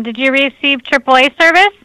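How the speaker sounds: ordinary talk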